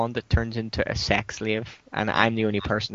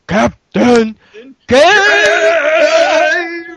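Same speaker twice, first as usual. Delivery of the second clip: high, loud, drawn-out battle cry; Captain Caveman; 11 dB louder, much slower than usual. Acted. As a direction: bellow it high, stretching the last word out long. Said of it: "Captain Caveman coming in going".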